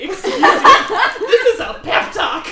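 loud, high indignation through laughter; laughing protest. Real, not acted. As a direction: protest while cracking up. Laughing mock protest at the joke.

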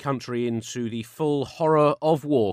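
grave newsreader voice; solemn headline delivery; news read. Acted. Mock serious news read.

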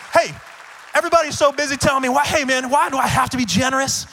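high, loud, fervent preaching; preaching at full force. Acted. Pastor urging the congregation to be generous, preaching loud and high. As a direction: drive the appeal home to the crowd. Peak of sermon appeal.

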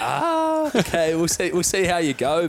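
high, dismissive take; skeptical coach voice. Retold or imitated. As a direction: humour the newcomer doubtfully. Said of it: "it's just like".

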